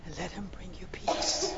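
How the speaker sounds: soft, hushed blessing; whispered invitation. Real, not acted. Preacher inviting congregation to receive peace, whispered. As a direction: invite them gently under your breath.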